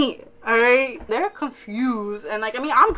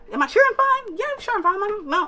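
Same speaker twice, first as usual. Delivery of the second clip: defensive fake reassurance; 9 semitones higher, faster than usual.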